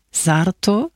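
astonished echo of a name, rising sharply at the end. A narrator's reading. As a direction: ask it back in surprise. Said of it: "rief Ballini erstaunt". (erstaunt nachgefragt)

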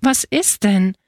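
plain reading voice

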